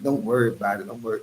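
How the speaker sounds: low, soft dismissive reply; brushing off. Acted. Telling nosy onlookers about the doodled six, quiet brush-off. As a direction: wave it away quietly and low. Models a calm reply to questioners.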